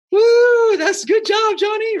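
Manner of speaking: put-on high, loud cheer for a little kid; cheering parent. Parent on the sideline cheering a six-year-old's kick, high loud cheer.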